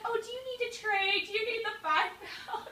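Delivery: high, soft instructor voice; imitated solicitous instructor. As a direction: offer help in a sweet mock-instructor voice, up high. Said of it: "she's like".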